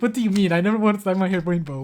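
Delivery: indignant quoted comeback; baffled protest. Retold or imitated. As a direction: blurt it back in disbelief. Acts out baffled reply to mom.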